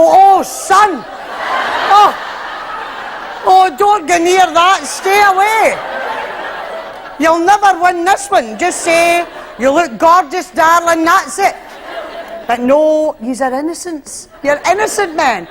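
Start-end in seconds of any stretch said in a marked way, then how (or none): 0.0-11.5 frantic hammed-up advice; urgent warning. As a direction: warn him off like a big sister. Woman warns naive husband.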